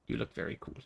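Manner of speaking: breathy whisper, very soft. Shown as a demonstration. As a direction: whisper it under your breath. Repeats the message softly.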